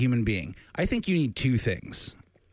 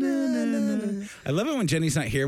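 put-on old-timey voice; 8 semitones higher, slower than usual. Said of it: "Jenny's old-timey voice".

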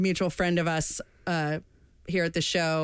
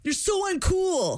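put-on much higher, bratty kid whine; mock tantrum; 10 semitones higher, slower than usual. Hosts replaying the son's outburst at dad, high bratty whine. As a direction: whine it like a spoiled boy. Continuing the son bit.

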